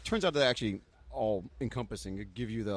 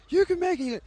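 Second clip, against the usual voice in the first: much higher, louder call of encouragement; cheering crowd; 4 dB louder than usual. Retold or imitated. Finished skaters cheering him on, high and loud. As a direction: shout it back at him to lift him. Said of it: "They're like".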